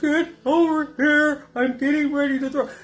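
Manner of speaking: high, strained, hurried call; urgent calling out. Retold or imitated. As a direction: re-enact a desperate summons to the paramedic. Calls paramedic while about to vomit.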